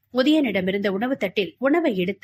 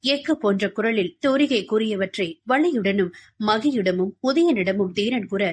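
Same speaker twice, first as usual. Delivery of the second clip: ordinary delivery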